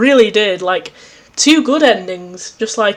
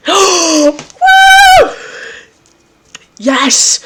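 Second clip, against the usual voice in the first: high, loud whoop after a gasp; gasp, then whoop with excitement; excited whoop; 9 semitones higher, 6 dB louder than usual. Real, not acted. Player cheering as Equius appears, excited whoop.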